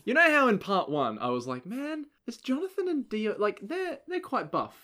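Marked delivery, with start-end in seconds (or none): 1.7-4.7 high-pitched. Retold or imitated. Quoting own past remark.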